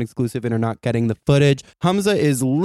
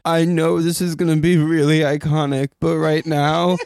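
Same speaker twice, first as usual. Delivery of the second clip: dramatic dying; 3 semitones higher than usual. Retold or imitated. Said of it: "you said".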